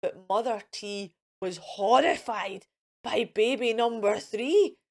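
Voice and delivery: ordinary delivery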